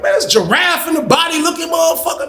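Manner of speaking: very high, squealed disbelief; astonished outburst. Retold or imitated. Teller's astonished reaction.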